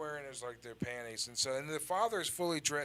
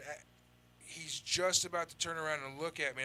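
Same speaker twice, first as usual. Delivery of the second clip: ordinary talk, nothing marked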